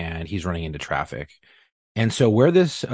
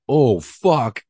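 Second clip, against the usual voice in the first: imitating a suspect's alarmed, louder cry; a touch of panic, higher; mock startled curse; 5 semitones higher, 5 dB louder than usual. Suspect spotting the cannon, mock startled curse.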